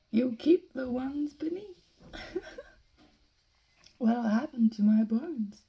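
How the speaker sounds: lilting recital, tickled by the verse; animated poem reading. Acted. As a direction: play up the rhymes with amused, lively emphasis. Reads rhymed question-and-answer poem.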